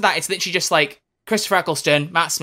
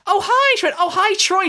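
much higher, bright, sweet greeting imitation; imitating Catherine; 14 semitones higher than usual. Fan imitating the actress greeting him, much higher mock-sweet. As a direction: squeak out a gushing celebrity hello. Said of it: "Catherine turned around and she went".

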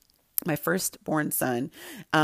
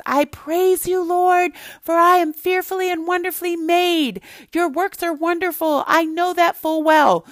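high, loud triumphant shout; yelling at the top of lungs; 12 semitones higher, 6 dB louder than usual. Retold or imitated. Mother and son end up shouting the verse together. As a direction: belt the verse out, full lungs. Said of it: "we both were yelling at the top of our lungs".

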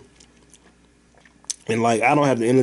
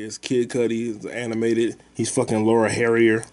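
normal talking voice

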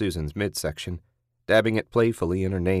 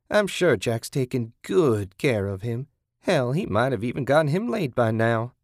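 higher-pitched, gloating captor; taunting captor; 5 semitones higher than usual. Acted. Kathy taunting captive about husband, gloating.

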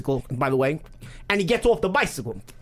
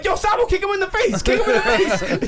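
egging-on shout; 11 semitones higher, 3 dB louder than usual. Retold or imitated. Urging Salvo on mid-fight.

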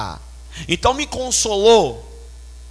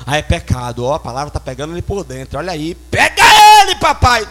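hammed-up church busybody, bursting louder at the end; mock consoler; 5 semitones lower, 6 dB louder than usual. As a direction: imitate a meddling sister urging God on. (imitação exagerada de irmã intrometida)

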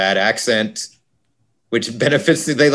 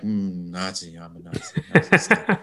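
much softer, drowsy murmur, half asleep; sleep-talk mumble; 3 semitones lower, 8 dB quieter than usual. Retold or imitated. Podcaster mimicking the woman muttering Nazi things in her sleep, soft and drowsy. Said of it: "lies there and just goes like".

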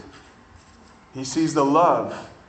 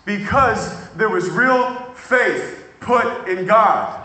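high, loud, fervent preaching; preaching at full force; 10 semitones higher, 11 dB louder than usual. Acted. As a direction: carry the room with rising fervour.

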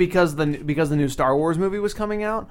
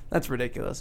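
ordinary talk

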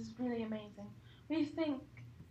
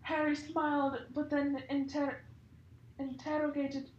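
normal talking voice

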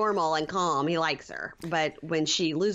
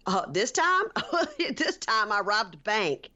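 laughing boast; 4 semitones higher than usual. Retold or imitated. Josh's boast retold with laugh.

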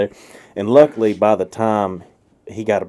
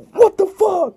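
startled outburst; slower than usual. Retold or imitated. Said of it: "He said".

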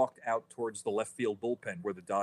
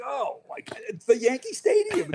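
much higher, laughing squeal; mock delight; 14 semitones higher, 4 dB louder than usual. Laughing at the old phrase with the hosts, high giddy delight. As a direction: gush with fake joy.

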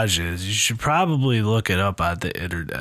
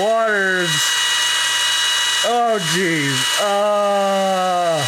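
high, strained panic; squealing discomfort, much higher and louder; panicked, high and loud; 12 semitones higher, 4 dB louder than usual. Real, not acted. Nose rinse, panicked.

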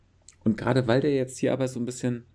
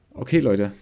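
ordinary talk, nothing marked

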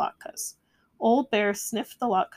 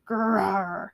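roar. A narrator's reading. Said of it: "Old Bear roared".

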